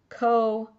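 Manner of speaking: 'koach' split as ko-ach, ending on guttural ch; koach demonstrated. Shown as a demonstration. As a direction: model koach in two clear syllables. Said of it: "is gonna be".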